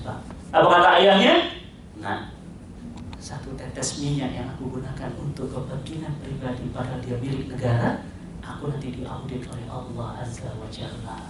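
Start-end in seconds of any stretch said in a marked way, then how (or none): 2.0-11.2 much softer, fading into a whisper; solemn hush. Acted. Caliph answering his son in the dark, hushed and solemn. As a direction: say it low, as a grave confession.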